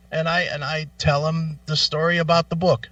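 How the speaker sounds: ordinary talk, nothing marked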